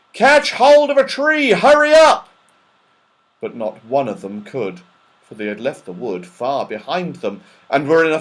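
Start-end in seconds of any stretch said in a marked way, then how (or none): 0.1-2.3 shout. Acted. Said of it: "he shouted to the others".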